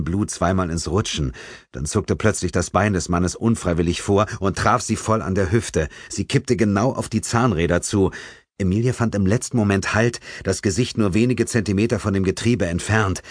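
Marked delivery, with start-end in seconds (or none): none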